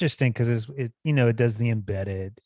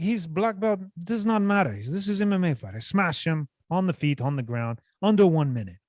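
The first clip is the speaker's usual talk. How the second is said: higher, mock Russian-accented fighter boast; mimicking the fighter's broken-English trash talk, higher; imitating Khamzat's accent; 6 semitones higher than usual. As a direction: lay down the threat in a put-on Dagestani accent, pitched up. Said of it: "It's like".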